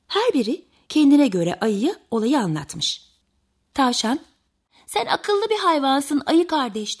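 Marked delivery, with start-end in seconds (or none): 4.9-7.0 pleading rabbit. A narrator's reading.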